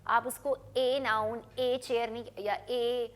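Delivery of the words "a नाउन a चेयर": wrong article "a", rhyming with "day"; letter-name A. Shown as a demonstration. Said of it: "करके pronounce नहीं करते". ("a" को "ए" बोलना)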